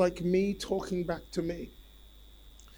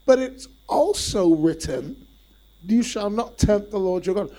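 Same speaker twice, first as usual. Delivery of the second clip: emphatic retort; 5 semitones higher, 9 dB louder than usual. Acted. Jesus rebuking Satan.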